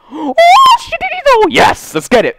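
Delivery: very high, loud surprised shouting; excited shout. Real, not acted. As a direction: yell it out in disbelief.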